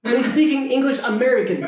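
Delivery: ordinary delivery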